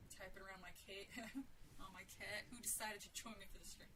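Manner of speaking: ordinary delivery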